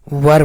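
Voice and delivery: वर as one syllable, neutral vowel into r; correct "were" pronunciation. Shown as a demonstration. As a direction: say वर like "wur", no ee or ay. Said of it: "वर पढ़ेंगे".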